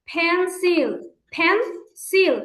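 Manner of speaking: পেন্সিল split into pen and sil; teacher models পেন্সিল for the class; syllable demo. Shown as a demonstration. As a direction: say পেন্সিল in two clear beats. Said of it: "পেন্সিল দুইটা ভাগ".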